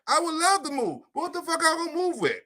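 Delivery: frustrated about having no money to move; exasperated. Real, not acted. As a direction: throw up your hands in disbelief. Exasperated punchline.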